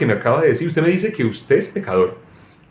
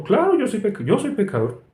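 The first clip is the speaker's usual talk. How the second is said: lightly voiced casual believer, higher start; breezy mock admission; 9 semitones higher than usual. Believer answers the preacher casually, mild mock. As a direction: toss it off like it's obvious.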